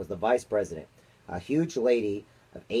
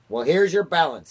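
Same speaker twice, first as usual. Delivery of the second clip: a touch of a snarl, louder; mild snarl; 6 semitones higher, 7 dB louder than usual. A narrator's reading. Miss Tibbs scolds the chief spy with a mild snarl. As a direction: snap it at him. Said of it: "snarled Miss Tibbs".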